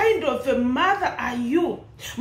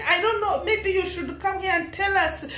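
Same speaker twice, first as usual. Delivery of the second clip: ordinary delivery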